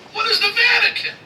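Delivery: high, loud, bewildered demand; high incredulous yell; baffled shout. Acted. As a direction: shout it like a confused ancient Roman. Ancient Roman quizzing modern guide.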